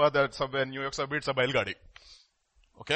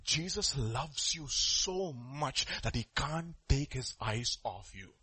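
soft, slow, tender reassurance; wise grandfather; 3 semitones lower, 9 dB quieter, slower than usual. Acted. Grandfather answers the grandchild, soft wise reassurance. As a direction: lower the volume and explain gently, unhurried. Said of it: "grandfather is a very wise man".

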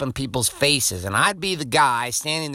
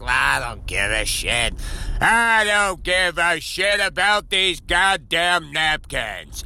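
mock careless customer; slower than usual. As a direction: drag it out like someone who couldn't care less. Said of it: "You're like".

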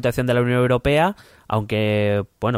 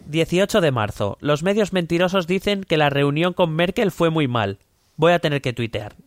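higher-pitched Trump diary voice; 6 semitones higher than usual. Acted. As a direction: read it as a pompous Trump. (voz de Trump, más aguda)

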